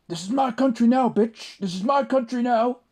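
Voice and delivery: high, loud jeering in a put-on Somali accent; imitated Somali accent, shouted and gloating; mock Somali accent taunt. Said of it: "proper, like, Somali accent".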